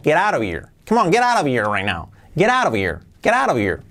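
"Get out of here" run together as "gerara vir"; t's turned to taps, one flowing word; native connected speech. Shown as a demonstration. Teacher imitates what a native would say, linked "Get out of here". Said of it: "Un nativo diría".

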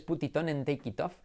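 put-on English with a heavy Spanish accent; mock Spanish-accented learner English. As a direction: imitate a Spaniard's syllable-by-syllable English. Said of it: "si vas con el acento español".

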